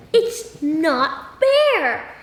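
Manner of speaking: slow, drawn-out "it's not fair"; whiny complaint. Retold or imitated. Reader acting out a child's complaint, slow and whiny. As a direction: drag it out like a sulking kid. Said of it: "have you ever said".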